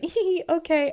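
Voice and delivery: high, giggly mock girlish reply; coy giggle. The narrator plays Alicent answering Daemon's request for her favour with a high, coy giggle. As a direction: titter as if flattered. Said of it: "And Alicent's like".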